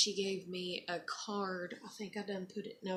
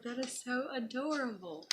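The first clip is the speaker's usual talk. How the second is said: higher, gushing over something cute; 6 semitones higher than usual. Retold or imitated. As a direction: melt over the little note. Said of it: "I was like".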